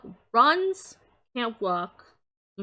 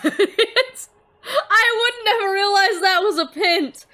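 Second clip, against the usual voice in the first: high, slow, laughing through the words; laughing disbelief; 9 semitones higher, 9 dB louder, slower than usual. Real, not acted. Streamer admitting she'd never have got it, laughing. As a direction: laugh out the confession.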